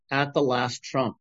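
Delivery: ordinary delivery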